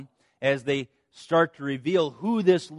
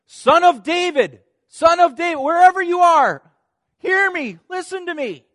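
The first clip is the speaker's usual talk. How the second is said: high, loud cry across a crowd; desperate shout; 13 semitones higher, 12 dB louder than usual. Acted. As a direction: call out to be heard over everyone. Blind man cries out to Jesus.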